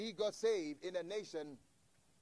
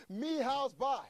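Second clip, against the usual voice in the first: louder, higher, pushing the broken words; a more insistent take of the buyer's broken English; 5 semitones higher, 6 dB louder, slower than usual. Retold or imitated. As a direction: press the three words harder, insisting. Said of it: "that's all he kept saying".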